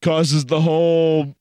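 ordinary talk

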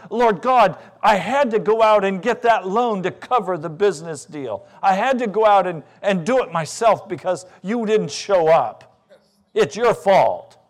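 loud, aggrieved prayer, blaming; a man shouting his grievance at God; loud accusing prayer to God; indignant complaint to God. Acted.

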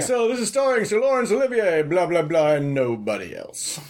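high mock announcer, sinking and slowing at the end; announcer billing. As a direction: mimic an old radio announcer reading the billing. Mimicking a radio announcer's cast billing.

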